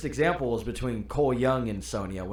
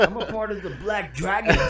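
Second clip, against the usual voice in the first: high-pitched, hammed-up boast; Kano; 6 semitones higher, 3 dB louder than usual. Quoting the movie villain for laughs, mock boastful. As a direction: put on a silly tough-guy voice. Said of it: "that's what he says".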